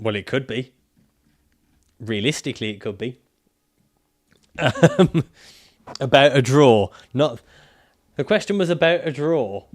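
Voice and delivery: usual speaking voice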